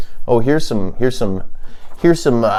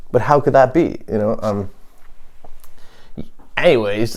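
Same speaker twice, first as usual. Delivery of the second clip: normal talking voice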